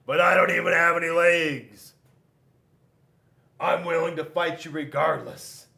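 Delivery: big, loud bragging rock warrior; loud boasting warrior. Acted. Rock brags to Scissors before the fight, loud boasting. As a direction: puff up and boast to the rival.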